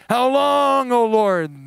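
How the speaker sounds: an impatient psalmist's plea, loud; crying out. Retold or imitated. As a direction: call it up to heaven. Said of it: "the prophet and the psalmist cry".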